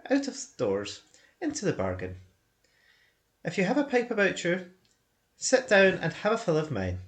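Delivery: genial hobbit voice. Acted. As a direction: offer the pipe like a contented hobbit. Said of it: "Then Bilbo sat down".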